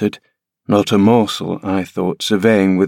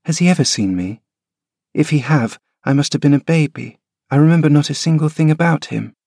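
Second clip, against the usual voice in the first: a higher, lightly voiced boy, quicker toward the end; young boy Linton; 6 semitones higher, faster than usual. Boy asking the nurse about his unknown father, lightly voiced. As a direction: play the timid son wondering about his father.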